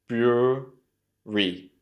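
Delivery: "Brewery" very slowly, broo... ree; syllable-by-syllable slow model of "Brewery". Shown as a demonstration. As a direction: stretch "Brewery" into two separated syllables. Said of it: "try and say it slowly".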